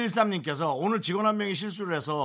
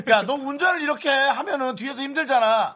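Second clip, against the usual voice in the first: high, loud mock scolding; hammed-up irritated outburst; acted annoyance; 11 semitones higher, 9 dB louder than usual. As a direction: scold the driver in a raised, high voice. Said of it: "그렇게 또 막 짜증나지 않아요".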